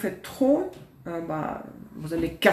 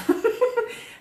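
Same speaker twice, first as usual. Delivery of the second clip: high laugh. Real, not acted. Laughs at the feather.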